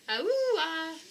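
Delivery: high whale cry; whale call. Acted. Naming the whale's sound for a child, high whale cry. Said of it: "a humpback whale is".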